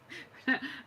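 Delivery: quick breathy giggle; short laugh. Real, not acted. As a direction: laugh at herself. Amused at her own accent.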